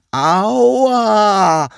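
long drawn-out, high, loud wail; slow, pained cry. A narrator's reading. Snail whose feelers were twisted, slow agonised cry. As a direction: stretch it out slowly in pain. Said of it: "rief die Schnecke langsam und schmerzgeplagt".

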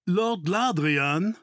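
stretched, lifted call of a title and name. A narrator's reading. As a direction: hail someone from behind, slowly. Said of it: "rief eine Stimme von hinten".

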